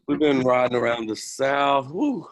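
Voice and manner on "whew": high, sliding "whew"; exhausted whew. Real, not acted. Instructor worn out from riding around the South, high whew. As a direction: let out a big whew of relief. Reacts to days of humid riding.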